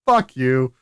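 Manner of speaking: normal talking voice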